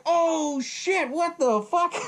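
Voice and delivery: high, drawn-out cry of shock; stunned outcry. Retold or imitated. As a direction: blurt amazed disbelief, pitch way up. Own retold shock at the ending.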